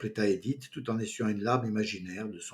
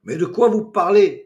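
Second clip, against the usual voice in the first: loud, higher indignant protest; 9 semitones higher, 13 dB louder than usual. A narrator's reading. Pascal protesting to the laughing meeting, loud and indignant. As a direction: push back hard, offended. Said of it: "protesta Pascal".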